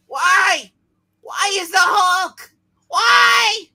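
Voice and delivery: loud, slow despairing yell; anguished shout. Acted. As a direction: cry out to the heavens. Mourning fallen hero, comic voice.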